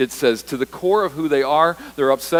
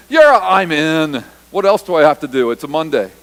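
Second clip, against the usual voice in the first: eager casual volunteer; 3 semitones higher, 10 dB louder than usual. Acted. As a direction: toss it off like it's nothing, loudly. Disciples casually volunteering, acted.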